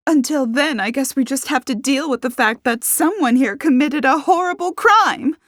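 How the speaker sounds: high, indignant, rising to loud; huffy complaint. A narrator's reading. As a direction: huff resentfully at the others. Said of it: "Harper huffed".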